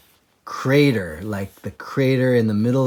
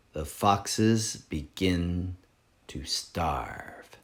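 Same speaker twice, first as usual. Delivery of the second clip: slow, much lower and softer, fading; ominous title; 4 semitones lower, 9 dB quieter, slower than usual. Acted. Reader teasing the next chapter, ominous hush. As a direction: drop to a dark hush on Starve. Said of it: "exciting one".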